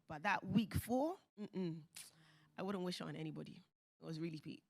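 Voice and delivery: ordinary delivery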